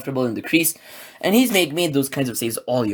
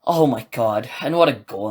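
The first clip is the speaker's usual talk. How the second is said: usual speaking voice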